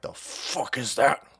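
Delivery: lightly voiced cranky old man; gruff grandpa. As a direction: grumble like a baffled grandpa. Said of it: "My grandpa would be like".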